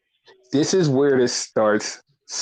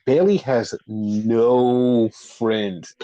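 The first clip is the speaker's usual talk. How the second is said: mock sad; 3 semitones lower, much slower than usual. Shown as a demonstration. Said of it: "Air quotes".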